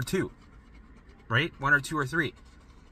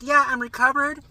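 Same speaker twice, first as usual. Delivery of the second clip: high, loud, overjoyed imitation of recovered people; mock ecstatic testimonial voice; gushing success story; 7 semitones higher, 6 dB louder than usual. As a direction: gush like someone who just got their life back. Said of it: "they're like".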